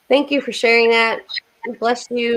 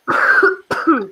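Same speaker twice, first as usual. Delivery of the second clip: a real dry cough; 6 semitones higher than usual. Real, not acted. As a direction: cough hard, then apologize. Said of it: "Sorry, guys".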